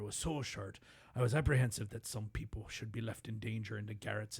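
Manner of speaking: low, quiet, grave Irish brogue; Irish accent, somber and low, like a film narrator; Liam Neeson Irish accent. Retold or imitated. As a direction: read the quote as a solemn Liam Neeson-style Irishman, hushed. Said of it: "I think that's a good accent".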